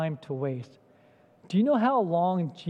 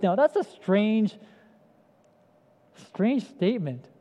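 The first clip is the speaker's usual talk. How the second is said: ordinary delivery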